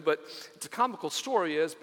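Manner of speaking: ordinary talk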